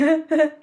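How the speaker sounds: short, high imitated baby giggle; loud, high mock chuckle; imitated baby laugh. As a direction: mimic a baby's sudden laugh. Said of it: "thinking of Roxy when she laughs".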